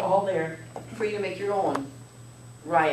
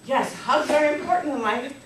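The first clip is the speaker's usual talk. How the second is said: ordinary talk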